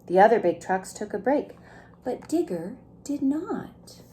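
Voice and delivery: plain reading voice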